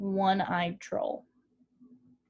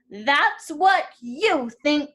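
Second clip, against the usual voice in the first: defiant big goat; 4 semitones higher, 7 dB louder, much slower than usual. A narrator's reading. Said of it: "said the biggest Billy Goat Gruff".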